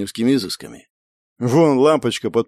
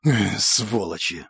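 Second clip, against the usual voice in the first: slow, lower grumbled curse; cursing under breath; 5 semitones lower, 4 dB quieter, slower than usual. A narrator's reading. Shumov waiting hungry for food and vodka, cursing. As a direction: grumble the oath out slowly. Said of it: "выругался Шумов".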